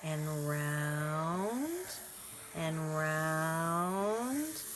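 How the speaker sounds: each "round" held long, sliding upward; stretched-out circling. Shown as a demonstration. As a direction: draw out every "round" as if tracing a spiral. Words trace the drawn spiral.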